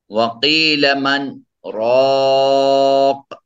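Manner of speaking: loud slow chant, brief silent hold after مَنْ without inhaling, then long رَاقٍ; chant, pause two beats after مَنْ on the same breath; breathless pause, saktah. Shown as a demonstration. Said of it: "دو حرکت کے برابر کم سے کم رکیں لیکن سانس".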